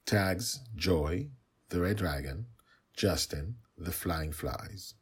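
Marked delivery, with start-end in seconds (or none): none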